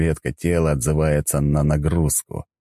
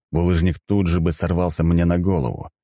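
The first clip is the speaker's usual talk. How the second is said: ordinary delivery, nothing marked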